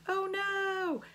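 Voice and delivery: high, hammed-up panicked squeal; a pretend shriek of book-list horror; mock freakout. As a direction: play a fake panic for laughs. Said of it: "this is where I would freak out".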